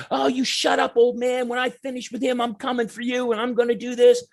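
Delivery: put-on a punk kid threatening the dad on the lawn, sneering; taunting punk. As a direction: threaten the old man cockily. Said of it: "and he goes".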